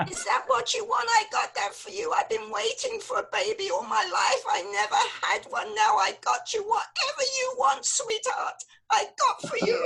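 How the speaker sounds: doting Yiddish mama, laughing; yiddish accent. Acted. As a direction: fuss over the baby in a Yiddish lilt.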